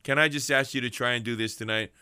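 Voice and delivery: ordinary delivery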